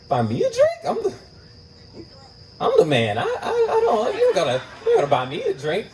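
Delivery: high, laughing incredulous voice; mock disbelief. Scoffing when the woman offers to buy him a drink, high and laughing. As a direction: scoff at the idea through laughter.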